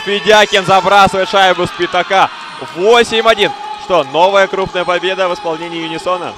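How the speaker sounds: shouted, high-pitched goal call; excited goal call. Real, not acted. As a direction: yell the goal at full voice. Goal call after the score.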